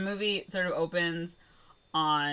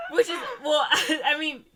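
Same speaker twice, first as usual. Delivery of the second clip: ordinary talk, nothing marked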